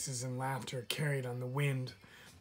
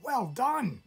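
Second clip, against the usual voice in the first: high, bright little chirp; 9 semitones higher, 5 dB louder than usual. A narrator's reading. Hidden tree frog chirping praise to the fawn. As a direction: chirp it from hiding. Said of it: "chirped a voice from nowhere".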